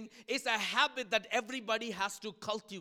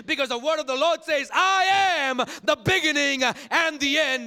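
climactic preaching; 11 dB louder than usual. Real, not acted. Preacher declaring the word of the Lord, climactic preaching. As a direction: build to a loud, shouted proclamation.

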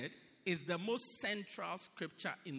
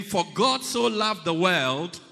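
louder recitation; 2 semitones higher, 8 dB louder than usual. Shown as a demonstration. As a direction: call it out with the whole church. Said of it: "Let's try it again".

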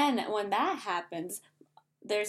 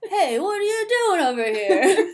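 loud, high, hammed-up Stallone accent; big goofy Stallone accent, breaking into laughter; Stallone impression; 9 semitones higher, 9 dB louder than usual. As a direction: belt out a comic Stallone accent. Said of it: "I don't know if that was my good".

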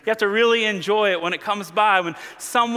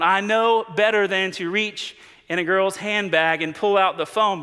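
normal talking voice

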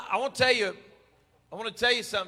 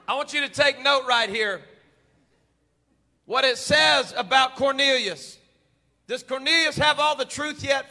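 loud, rising sermon preaching; 3 semitones higher, 5 dB louder than usual. Acted. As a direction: preach it with building fire. Preaching builds to full force.

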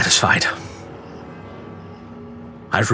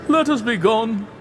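much higher, pleading Let us be gone; begging to leave, voice lifted high; Fortunato pleading; 10 semitones higher, 3 dB quieter than usual. A narrator's reading. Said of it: "The voice said".

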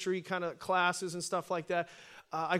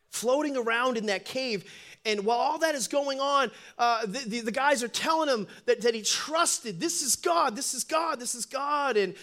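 loud, raised preacher's delivery; high-pitched, forceful sermon voice; impassioned preaching; 6 semitones higher, 6 dB louder than usual. Acted.

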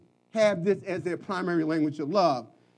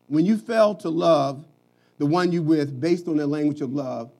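ordinary talk

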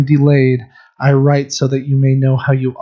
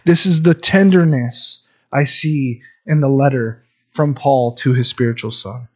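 ordinary delivery

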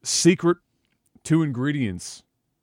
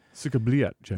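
put-on Russian accent; 5 dB quieter than usual. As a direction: say "Sikabliat" softly, in a Russian accent. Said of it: "I'm not here for my Russian accent".

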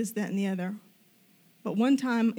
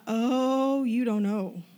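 retold amazement; much slower than usual. Retelling own excited reaction.

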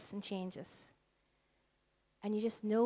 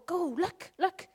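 coaxing a dog; 8 semitones higher, slower than usual. Retold or imitated. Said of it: "I'll go".

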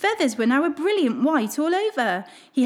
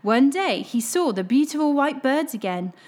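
ordinary delivery, nothing marked

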